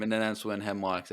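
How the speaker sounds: usual speaking voice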